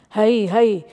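loud drawn-out spook call; devilish shout. Acted. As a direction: call out loudly like a forest demon. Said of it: "mit teuflischer Stimm". (lauter, teuflischer Spukruf)

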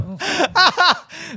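a real laugh at the banter; high laugh. Real, not acted.

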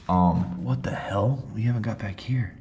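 ordinary talk, nothing marked

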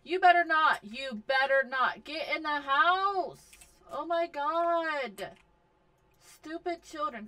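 loud exasperated scolding; scolding yell. Real, not acted. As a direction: threaten the sim like a fed-up mom. Scolding sims loudly.

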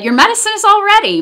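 upbeat pharmacist voice; cheerful pharmacist. A narrator's reading. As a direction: brightly tell the child it's ready. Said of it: "She said".

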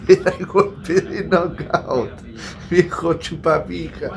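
mocking imitation. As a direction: parody the pundit with scorn. Mocks commentator after laughing. (imitación burlona y lenta)

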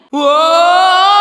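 loud, high wow; thrilled outcry; amazed shout. Acted. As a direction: shout it in wonder. Amazed cry at discovery.

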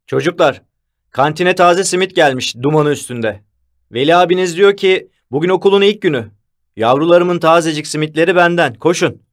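high, rousing shout; calling out. A narrator's reading. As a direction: announce it to the whole crowd. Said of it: "diye bağırdı".

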